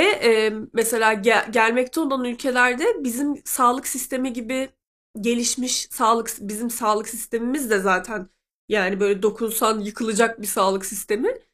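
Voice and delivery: ordinary delivery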